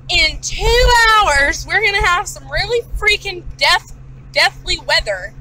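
dramatic dread. Retold or imitated. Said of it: "sitting here thinking".

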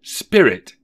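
high, loud call; desperate cry. A narrator's reading. Scrooge calling to the spirit, desperate. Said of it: "he cried".

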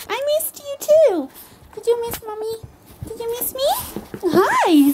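put-on high, loud cooing to a baby; mother greeting her excited baby, high and cooing; baby talk. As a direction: gush over the little one.

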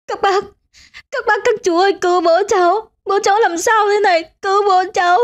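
very high, frantic plea; pleading for help. A narrator's reading. Daughter begging neighbours to save her father, high frantic pleading. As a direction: beg the neighbours desperately. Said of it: "chị Nhưỡng cầu cứu".

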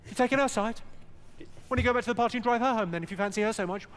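high, fast jealous tirade; jealous girlfriend. Acted. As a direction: rattle off the accusations, high and indignant. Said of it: "She went".

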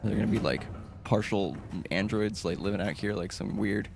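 ordinary talk

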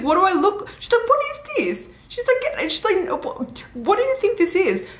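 very high, squeaky disbelief; hammed-up outrage. Heroine sputtering at their harem proposition, hammed-up outrage. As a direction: sputter in a comically high imitation, breaking off. Mounting acted reaction to proposition.

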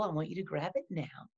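ordinary talk

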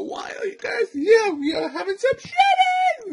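high, loud squealing excitement; excited squeal. Real, not acted. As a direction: squeal it with glee. Excited cereal announcement, cry shout.

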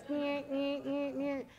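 high nasal-free mock chomp noises "nyeh"; Pac-Man chomping. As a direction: chomp like Miss Pac-Man, light and quick. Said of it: "Miss Pac-Man goes".

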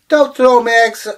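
loud, high cry of protest; old woman yelling at the leprechaun throwing her eggs; shouting. Acted. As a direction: yell at the egg thief.

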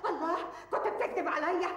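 grieving mother wailing at her son; wailing reproach. Acted. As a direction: cry out in grief and accusation. Mother's despair rising.

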